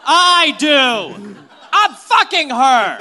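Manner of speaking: loud laughing outburst; mock shout. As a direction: yell it out, laughing. Acting wedding objector shouting.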